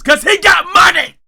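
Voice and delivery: loud high exclamation; shouting. Real, not acted. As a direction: yell the punchline. Punchline exclaimed.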